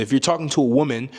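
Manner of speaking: ordinary delivery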